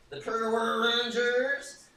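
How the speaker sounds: high, loud, drawn-out Power Rangers call; put-on Power Rangers voice. Streamer imitating the Power Rangers call, high and drawn-out. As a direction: belt the name out high and stretched. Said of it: "allowed to talk like that".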